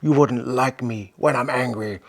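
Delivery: roaring monster shout; Hulk voice. Pretend. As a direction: bellow like an angry green giant. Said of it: "I think I'd be a pretty good Hulk".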